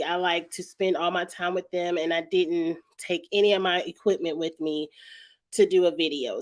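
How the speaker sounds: ordinary talk, nothing marked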